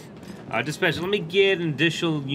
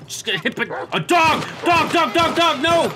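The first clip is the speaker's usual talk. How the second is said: panicked shouting; 11 semitones higher, slower than usual. Pretend. Dog attacking him.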